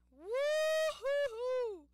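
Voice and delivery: very high, loud, slow cheer; joyful whoop. Real, not acted. Player whooping after making the jump. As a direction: whoop in triumph.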